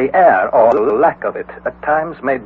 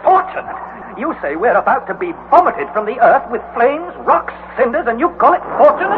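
rising higher in disbelief; can't believe the uncle; incredulous; 8 semitones higher than usual. Acted. Incredulous nephew.

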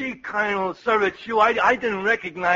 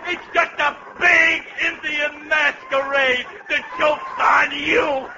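raised, crowing call; gleeful prank reveal; 6 semitones higher than usual. Acted. Jenkins springing an April Fool on General Custer, high and gleeful. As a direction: crow it out high, slowing on the final line.

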